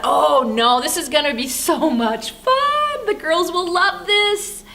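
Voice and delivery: gushing grandma optimism, retold; gushing confidence. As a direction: brush off the warning, stretching the excited words. Eagerly overrules son's warning.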